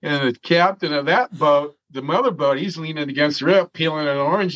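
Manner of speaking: ordinary talk, nothing marked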